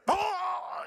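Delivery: high, drawn-out "oh" of a man falling; mock cry of alarm. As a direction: imitate a neighbor toppling over. Acts neighbor falling off fence.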